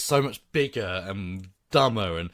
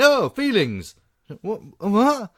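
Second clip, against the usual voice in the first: mocking characters; 10 semitones higher, 5 dB louder, slower than usual. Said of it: "the people kept then saying".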